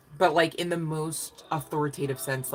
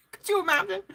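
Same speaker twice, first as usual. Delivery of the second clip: high, laughing, can't believe it; laughing disbelief; 3 dB louder, faster than usual. Real, not acted. Laughing at the idea of a dog collecting rent. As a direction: giggle the question out.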